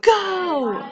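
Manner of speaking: loud, high roar of a start signal. A narrator's reading. Coach roaring the start to his swimmers, loud. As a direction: roar it to launch the race. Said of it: "roared the coach".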